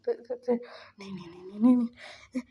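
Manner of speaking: mock panic, stammering and uneven; retold distress in stuttered bursts; half-hearted cry of pain and denial; panicked screaming. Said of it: "चिल्ला रहा था".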